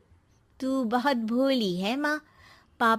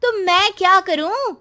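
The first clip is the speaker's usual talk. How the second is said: higher, louder dismissive snap; rude retort; 6 semitones higher, 4 dB louder than usual. Acted. Daughter snaps rudely at her lonely mother. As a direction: brush her off, annoyed and rude.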